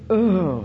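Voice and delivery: short loud groan, "Ugh" pushed out; groan of misery. Shown as a demonstration. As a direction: groan it out as if weighed down. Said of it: "It, it, it is a groan".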